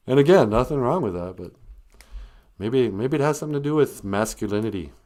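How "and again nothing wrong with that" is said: ordinary talk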